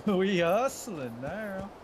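slow, high, stretched mock boast; drawn-out high mock swagger. Echoes friend, drawn out.

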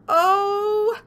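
high, loud worried gobble; alarmed turkey. A narrator's reading. Turkey worried he is next, high loud gobbling.

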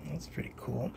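breathy hushed aside; whisper. Real, not acted. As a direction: whisper it to yourself, impressed. Quiet aside over cards.

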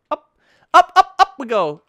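high, loud up up up; climbing cheer. Real, not acted. Gamer cheering his climber upward. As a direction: cheer the character up the ledge.

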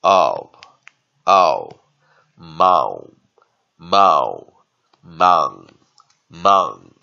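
"ow" loud, falling glide, then built into "mow" and "mang"; diphthong drill. Shown as a demonstration. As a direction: drill "mow", "mang" one at a time, loudly. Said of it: "我们有个双元音".

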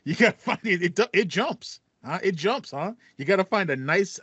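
high, chuckling replay of the cracked line; laughing self-mockery. Real, not acted. Host laughing off his voice crack while co-host teases him. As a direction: laugh at your own voice jump.

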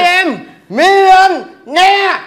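slow, loud and high, savoring every word; triumphant announcement. Acted. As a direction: announce the jackpot in grand English. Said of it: "Dịch ra tiếng Việt".

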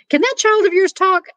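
high-pitched hollered question; Mama's high calling voice; acted mother calling. A narrator's reading. Mama calls up to Stella.

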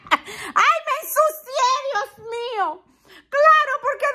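words bubbling up through laughter; laughing. Real, not acted. Telling subscribers about the scare, laughing as she talks. Laughing while retelling fright.

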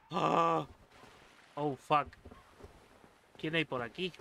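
usual speaking voice

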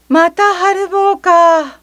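high, slow weary sigh of a voice; bored grown-up. Acted. Household member shrugging off the child's fall, wearily. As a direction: drag it out, unimpressed. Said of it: "と言ったきりで".

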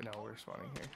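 usual speaking voice

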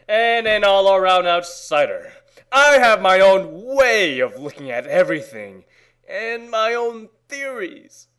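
ordinary talk, nothing marked

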